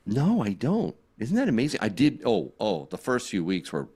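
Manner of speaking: normal talking voice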